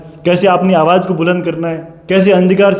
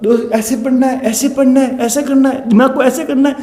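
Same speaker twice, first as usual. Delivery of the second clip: higher-pitched mock sermon voice, preachy and patronising; mocking the leader's voice; 5 semitones higher than usual. Vlogger mocks the bearded baba advising exam students, in a higher, preachy mock voice. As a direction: ham it up like a guru lecturing students. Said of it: "Dhauli दाढ़ी वाले बाबा".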